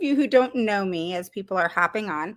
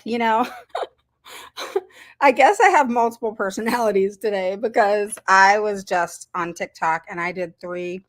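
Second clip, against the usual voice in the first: normal talking voice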